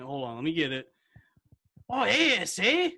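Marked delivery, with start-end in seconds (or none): 1.9-2.9 high-pitched attempt at a Mexican accent; tentative Mexican accent. Friend hunting for a fusion restaurant accent, mock Mexican. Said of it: "trying to figure out the accent".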